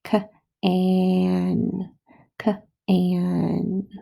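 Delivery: K-an stretched into its sounds; teacher segmenting sounds; sounding out. Shown as a demonstration. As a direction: sound out K-an slowly for kids. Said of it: "I hear can".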